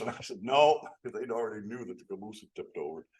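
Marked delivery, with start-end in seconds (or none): 0.4-0.9 emphatic no. Retold or imitated. Emphatic retold denial.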